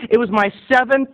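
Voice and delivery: usual speaking voice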